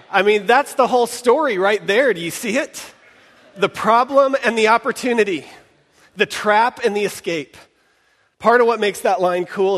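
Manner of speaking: rising delight in the story; enthusiastic. Real, not acted. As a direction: sell the idea with excitement. Enthused about the opening line.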